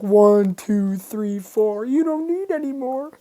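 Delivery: high mock count, climbing at the end; mimicked whiny kid. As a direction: imitate a kid counting, pitch rising sharply. Mocking the kid who sat out recess.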